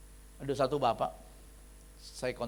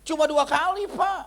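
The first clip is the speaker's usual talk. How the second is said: high, loud mock-wife voice; protesting wife; 12 semitones higher, 9 dB louder than usual. Wife defending herself to the pastor, high loud mock protest. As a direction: imitate a wife loudly pleading her case, pitched right up. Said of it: "Dia bilang begini".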